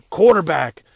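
loud, punched title card; mock graphic announcement. Mocking the highlight reel's pop-up graphic, loud punch. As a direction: bark it like a flashy caption.

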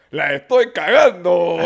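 loud, drawn-out comic outcry; exclaimed blunder. Retold or imitated. Comedian [name] realizes he has embarrassed a couple, exclaimed blunder. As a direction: blurt it out big and slow, hammed up.

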